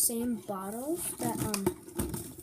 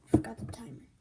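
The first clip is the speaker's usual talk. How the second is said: whispered aside to himself; whispering; 3 semitones lower than usual. Real, not acted. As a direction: drop to a whisper. Whispered aside about forgotten timer.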